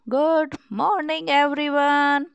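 high, slow sing-out greeting; high, drawn-out hello; playful town greeting. A narrator's reading. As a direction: call a morning hello to a whole toy town. Annie voices her toy town.

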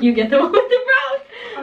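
high giggling tease; laughing tease. Real, not acted. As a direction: laugh while pushing it on her. Teasing friend over gross bean.

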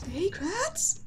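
high, rising excited question; thrilled, high-pitched wondering; excited discovery. Pretend. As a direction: squeak it upward in delight. Thrilled at finding a secret.

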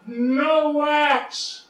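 put-on drunk yelling. Drunk yelling at spraying hydrant.

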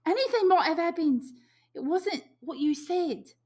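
put-on high, worried boy's voice; anxious boy. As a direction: fret and protest. Piggy voiced high throughout.

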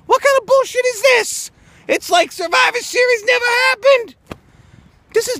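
shrill ranting yell at the Authority; outraged high shout. Real, not acted. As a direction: scream it at the bosses in outrage.

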